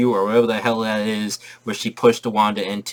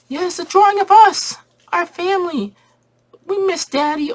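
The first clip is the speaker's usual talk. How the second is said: put-on squeaky high little kids calling Mommy; kid voices; 5 dB louder than usual. Kids begging their mom to come see. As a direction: chirp it up high like excited little boys.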